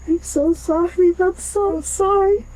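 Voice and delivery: high, slow tearful sobbing apology; crying and begging forgiveness. Real, not acted. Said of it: "he is crying".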